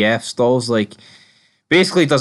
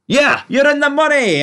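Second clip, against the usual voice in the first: mock high-pitched character voice; 13 semitones higher than usual. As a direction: squeak it out like a cartoon character.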